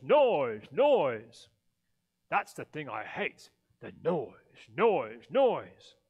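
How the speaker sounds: loud, building Grinch growl. A narrator's reading. The Grinch raging about the Whos' noise, loud growling. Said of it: "Then he growled".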